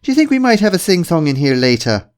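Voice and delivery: high, loud, jolly mock-Irish lilt; bright-spirited stage Irishman; cheerful fake Irish. A narrator's reading. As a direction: chirp it merrily in a put-on Irish accent. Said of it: "said in a cheerful voice".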